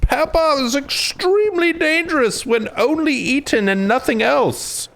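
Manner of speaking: very high, hammed-up warning voice; mock alarm. Mocking the claim that pepper is dangerous, hammed-up alarm.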